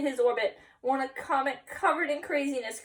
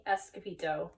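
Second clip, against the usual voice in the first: plain reading voice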